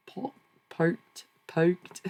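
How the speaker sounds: high, groping stab at an Australian vowel; "poked", a badly faked Australian "packed"; Australian accent attempt. Shown as a demonstration. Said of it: "How do Australians say packed?".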